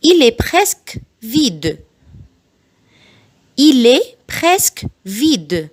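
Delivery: very slow, each syllable spelled out; slow it right down so parents can copy; slow model sentence for learners; slow teaching read. Shown as a demonstration. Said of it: "بعيد الجمل بالراحة جدًا".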